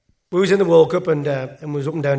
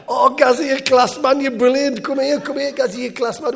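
high, loud acted elation; mock jubilant cheering; 10 semitones higher, 6 dB louder than usual. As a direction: re-enact the lads cheering a winning bet, pitched way up. Retold cheering as the horse wins.

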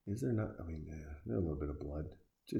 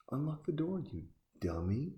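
"unlock the door" jumps much higher, rising in disbelief; exasperated; 9 semitones higher than usual. Real, not acted. Yelling advice at the screen.

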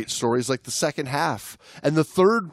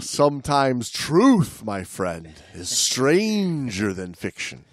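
dramatic reading; 3 semitones lower, slower than usual. Acted. Dramatic reading of story ending.

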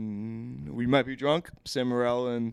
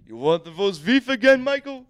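higher, louder deli counter voice; lightly put-on deli guy patter; 9 semitones higher, 4 dB louder than usual. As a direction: take an order like a deli man. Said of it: "like a deli guy".

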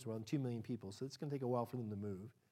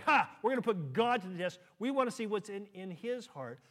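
mocking defiance; 6 semitones higher, 7 dB louder than usual. Mocking the people's challenge.

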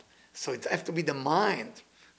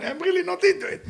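mock bored wife; 4 dB louder than usual. Bored wife answering husband.

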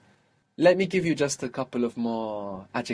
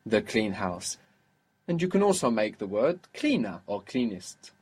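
ordinary talk

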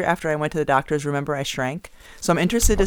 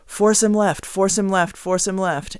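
higher, louder courtside instruction; coaching call to a player; 6 semitones higher, 5 dB louder than usual. Shown as a demonstration. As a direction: call it out to your own player as advice. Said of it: "saying to your player".

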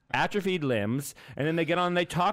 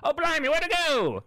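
put-on New Zealand accent; 12 semitones higher than usual. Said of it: "That's my New Zealand accent".

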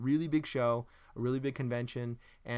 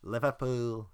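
attempted Liverpool accent; slower than usual. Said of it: "Eh, getting there".

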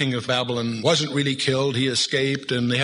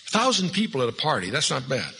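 ordinary delivery

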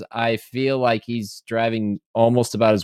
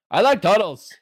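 put-on much higher, louder, childlike; six-year-old voice; 11 semitones higher, 4 dB louder than usual. Mocking the Dutch accent as a small child, high and childish. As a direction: say it like a little kid proud of himself. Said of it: "sounded more like a six-year-old who's like".

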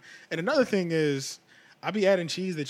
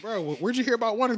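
surprised; 7 semitones higher, faster than usual. Retold or imitated. Retelling surprised reaction to friend.

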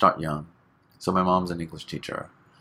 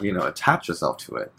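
ordinary talk, nothing marked

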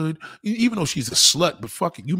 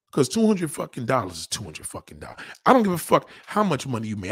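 usual speaking voice

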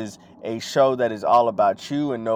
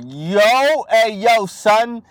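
New York talk; 8 semitones higher, 6 dB louder than usual. Retold or imitated. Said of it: "they're like".

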